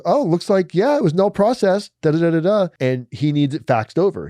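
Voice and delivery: hint of a chirpy clerk on the phone; court clerk voice.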